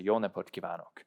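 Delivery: Jó napot kívánok said in natural Hungarian; Jó napot kívánok, spoken smoothly; Hungarian greeting. Shown as a demonstration. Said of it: "So you would say".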